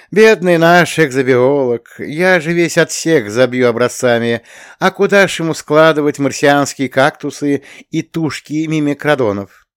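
high, mock-sorrowful teasing; playful, exaggerated commiseration; hypocritical pity. A narrator's reading. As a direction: lament with put-on sympathy. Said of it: "лицемерно вздохнул, косясь на Строгова".

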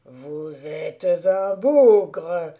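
put-on loud, very slow drunken exclamation; exclaiming. A narrator's reading. Drunk roadman thanks the stranger, loud and slow. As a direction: beam it out, drunk and delighted. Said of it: "s'écria-t-il".

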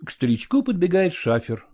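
plain reading voice

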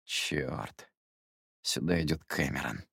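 low, soft, crestfallen mutter; sinking voice. A narrator's reading. Jerome spots Cameron on the dance floor, voice sinking. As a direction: let the voice drop away in dismay. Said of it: "проговорил упавшим голосом".

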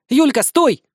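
loud call across the street. Acted. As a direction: shout her name to stop her. Nastya calls out from afar.

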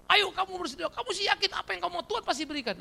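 high, fast mocking preacher voice. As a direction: parody a pushy prosperity preacher. Mocks false faith preaching. (nada pengkhotbah yang diejek)

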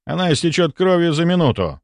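urgent objection. A narrator's reading. The narrator pushes the scalpel away, objecting urgently. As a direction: warn him off sharply.

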